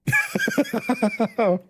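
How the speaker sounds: squeaky giggle; high laugh. Real, not acted.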